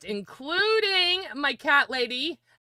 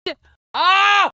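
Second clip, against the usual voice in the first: excited shout; 9 semitones higher, 8 dB louder than usual. Real, not acted. Reacting to big news.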